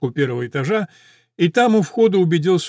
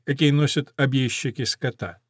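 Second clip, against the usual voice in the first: usual speaking voice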